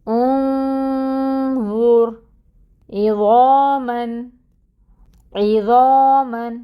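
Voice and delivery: انظر and عظاماً with a full, heavy ظ, drawn out; heavy zoe recitation. Shown as a demonstration. Teacher reciting correct heavy ظ examples slowly. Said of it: "تو کیسے پڑھیں گے".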